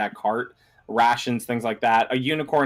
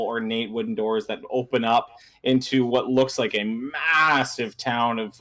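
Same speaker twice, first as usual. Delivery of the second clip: normal talking voice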